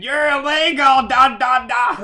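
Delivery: mock yell. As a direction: bark it out like a crazed woman mocking him. Mocking the yelling woman.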